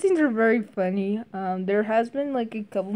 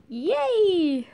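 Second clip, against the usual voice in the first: high bright squeal of victory; cheery win cry; 10 semitones higher than usual. Retold or imitated. Reviewer imitating Cinnamon's win quote, high happy squeal. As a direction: cheer like a cute girl who just won. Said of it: "her win quote is".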